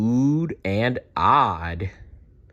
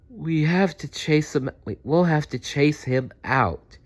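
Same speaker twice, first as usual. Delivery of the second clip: sleepy; 7 semitones higher than usual. Acted. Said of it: "said Dazzle sleepily".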